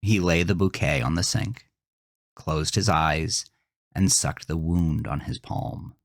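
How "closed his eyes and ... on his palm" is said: plain reading voice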